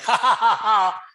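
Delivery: high, loud fake ha ha; playful mock laugh; random laugh. Shown as a demonstration. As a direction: toss off a quick laugh. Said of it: "rather than just randomly laugh like".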